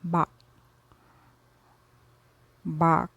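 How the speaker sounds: Bach with a throaty gargled ch at the end. Shown as a demonstration. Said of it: "like a gargled K, as in German".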